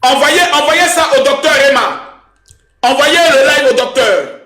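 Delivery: high-pitched urgent instruction; calling out. Real, not acted. Host telling viewers to send the live on, calling out. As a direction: call it out high to the whole audience.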